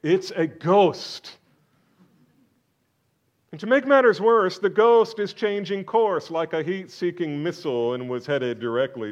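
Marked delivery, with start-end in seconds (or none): 0.0-1.4 disciples' cry. Acted. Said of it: "they immediately concluded". 3.5-7.9 loud, high, driving preacher's questions; preaching at full force. Acted. Preacher demanding answers from the congregation, at full force.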